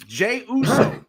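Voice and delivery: ordinary talk, nothing marked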